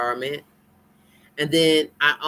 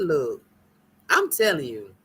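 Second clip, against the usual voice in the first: ordinary delivery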